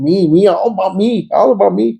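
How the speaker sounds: mocking self-centered me. Mocking his own selfishness.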